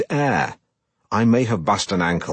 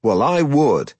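put-on mid-Atlantic accent; 6 semitones higher, 4 dB louder, slower than usual. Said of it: "his ridiculous mid-Atlantic accent".

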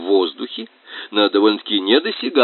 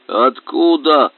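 grunting boar voice; 6 semitones higher, 4 dB louder, slower than usual. Acted. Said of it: "хрюкал кабан".

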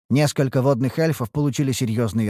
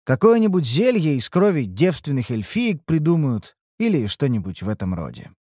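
ordinary delivery

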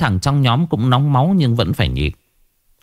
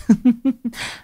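cackling laugh; 13 semitones higher than usual. Acted.

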